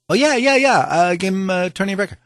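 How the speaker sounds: eager yes. Retold or imitated. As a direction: jump at the question. Retells eager answer on phone.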